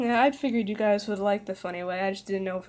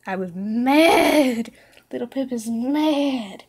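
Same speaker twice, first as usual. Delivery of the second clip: louder, higher, slow drawn-out cry of madness; hammed-up mad cry; 4 semitones higher, 8 dB louder, much slower than usual. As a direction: ham up the character snapping. Dramatizing narrator's madness.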